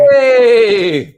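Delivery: big excited hey; loud greeting shout. Real, not acted. Greeting the arriving guest loudly.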